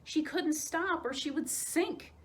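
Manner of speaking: usual speaking voice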